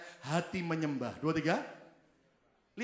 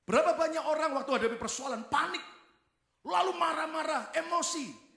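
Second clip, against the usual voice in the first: higher and louder, mimicking people who panic and rage; mock angry outburst; 8 semitones higher, 4 dB louder than usual. Preacher mocking hot-tempered people, high and loud.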